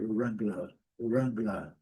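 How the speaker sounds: British "Wrangler" with silent final R; British pronunciation. Shown as a demonstration. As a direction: end British "Wrangler" on an open "-luh". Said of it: "o R não é pronunciado".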